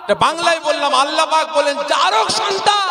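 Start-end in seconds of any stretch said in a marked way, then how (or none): 1.9-2.9 high shouted voice of God; shouted divine verdict. Acted. Preacher voicing God's verdict to the Prophet, shouted high. Allah's answer shouted in the story.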